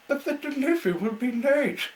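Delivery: very high, loud anguished wail; distressed cry. Acted. The engine cries out to Mister Woody, high and loud. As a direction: panic about letting friend down. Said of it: "the little engine cried".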